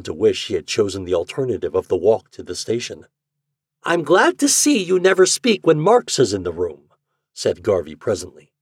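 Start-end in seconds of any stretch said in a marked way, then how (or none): 3.8-6.9 high, loud, excited remark; growing excitement. A narrator's reading. Garvey to the secretary about Marx, high and excited. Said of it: "his companion's excitement seemed to be rapidly growing".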